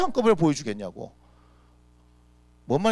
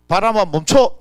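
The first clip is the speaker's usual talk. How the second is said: loud command; 4 semitones higher, 9 dB louder, slower than usual. Acted. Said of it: "이게 짧습니까?".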